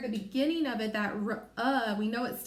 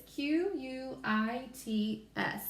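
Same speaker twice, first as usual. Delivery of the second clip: slow letter-by-letter spelling; spelling slowly; 3 semitones higher, much slower than usual. Shown as a demonstration. Teacher spelling for young learners, slow letters. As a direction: spell it out one letter at a time. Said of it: "So we spell quits".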